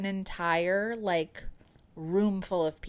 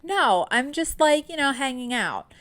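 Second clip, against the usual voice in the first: high, loud, sarcastic quip; 8 semitones higher, 5 dB louder than usual. Pretend. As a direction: throw it off with heavy sarcasm. Sarcastic reply mid-contraction.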